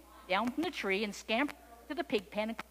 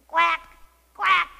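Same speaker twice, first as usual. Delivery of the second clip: loud, raised, short quacks; duck quacks; 8 semitones higher, 15 dB louder than usual. A narrator's reading. Duck answers the kitten with loud, high quacks. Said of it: "said the duck".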